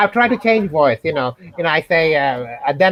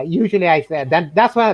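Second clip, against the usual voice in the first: ordinary delivery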